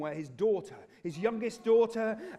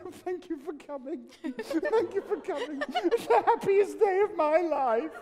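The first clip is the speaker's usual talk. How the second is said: hammed-up giddy bride, higher pitched; mock bride voice; 8 semitones higher than usual. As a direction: play the simpering bride. Paik in wedding dress greets guests.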